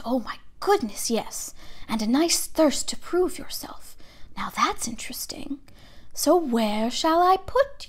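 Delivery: soft, slow, breathy murmur of the hat; small voice in his ear. A narrator's reading. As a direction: mull it over privately, close in. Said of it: "said a small voice in his ear".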